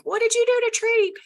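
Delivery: very high, loud imitation of eager questioners; exaggerated high-pitched group chorus; mock clamoring crowd. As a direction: squeal it as a mocking impression. Mimicking people in online groups.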